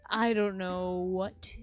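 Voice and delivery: normal talking voice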